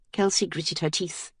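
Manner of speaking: ordinary delivery, nothing marked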